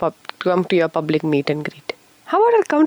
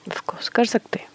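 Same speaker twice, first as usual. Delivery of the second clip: whisper; 3 semitones higher, 6 dB quieter, much faster than usual. Real, not acted. Jokes about ending the show.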